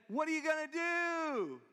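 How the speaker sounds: high, loud holler, last word stretched; acted long-drawn shout from below; crying out for help. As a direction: holler up to your rescuer. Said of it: "crying out for help".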